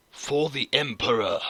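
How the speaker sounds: loud, gruff war cry; space marine voice. Shown as a demonstration. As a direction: bark it like a zealous soldier. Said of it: "voice sound like a space marine".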